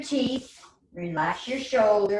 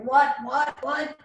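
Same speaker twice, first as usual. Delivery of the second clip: mock bewildered, rising higher on each "what"; confused brain; 6 semitones higher than usual. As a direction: play the baffled brain, high. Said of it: "It's like".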